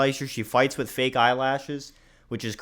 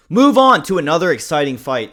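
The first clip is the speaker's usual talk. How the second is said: louder, higher hyped transition; hype; 5 semitones higher, 10 dB louder than usual. Real, not acted. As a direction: pump up the next fight. Hyped transition to next fight.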